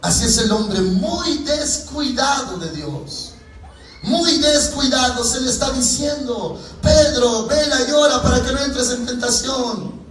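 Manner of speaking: high, urgent pulpit cry; impassioned preacher, pitched well up; full-force preaching. Acted.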